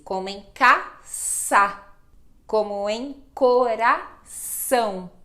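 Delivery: caça and coração said slowly with a clear S; S sound demo. Shown as a demonstration. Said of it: "Este é um som de S". (som de S, devagar)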